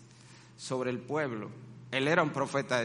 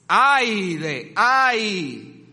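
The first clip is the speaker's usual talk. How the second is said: loud woe cry; 5 semitones higher, 10 dB louder, much slower than usual. Shown as a demonstration. Demonstrates the woes.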